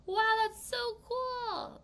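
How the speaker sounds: high, slow wow after a gasp; amazed gasp. Pretend. A child watching a magician's trick, gasping in amazement. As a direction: marvel like a kid at a magic trick. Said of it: "They're like".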